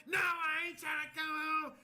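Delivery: high, loud, drawn-out shout; drunken yelled refusal. Retold or imitated. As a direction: imitate a belligerent girl yelling back. Imitates the drunk girl refusing.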